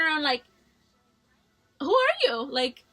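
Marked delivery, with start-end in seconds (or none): 1.8-2.8 indignant challenge. Retold or imitated. Snapping back at a classmate she doesn't know, indignant. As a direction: throw it out as an affronted challenge. Turns on a stranger in retold quarrel.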